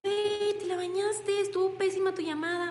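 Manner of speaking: high, scornful mock scolding; mock mentor scorning a rookie's call; mocking harsh put-down. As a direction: sneer it like a rude mentor. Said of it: "No le vas a decir".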